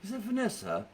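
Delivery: high mock British accent; a playful British accent. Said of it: "like you're from Great Britain".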